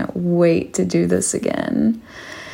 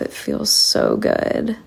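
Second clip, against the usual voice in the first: breathy whisper. Acted. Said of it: "And I say".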